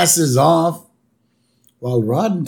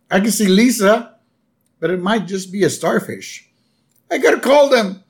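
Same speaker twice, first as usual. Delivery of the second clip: higher, louder rushed worry; hammed-up fretting dad; voicing Homer in a mild panic; mock panicky Homer; 6 semitones higher, 3 dB louder than usual.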